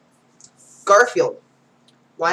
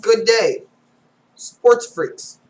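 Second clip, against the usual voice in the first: 1 tennis instructor; 4 semitones higher, 6 dB louder, much slower than usual. Acted.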